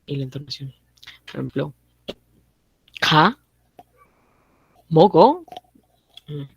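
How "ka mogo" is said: "ka, mogo" said loud, with a rising question tune; question intonation. Shown as a demonstration. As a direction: ask "ka, mogo" clearly as a question. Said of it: "entonación, ¿no?". ("ka, mogo" con entonación de pregunta, subiendo y fuerte)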